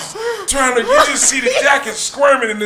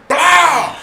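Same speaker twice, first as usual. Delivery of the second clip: mild gunshot noise; 6 semitones higher, 4 dB louder than usual. Retold or imitated. Gunshot imitated.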